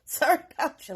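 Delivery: ordinary delivery, nothing marked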